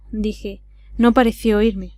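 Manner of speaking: usual speaking voice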